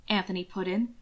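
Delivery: ordinary delivery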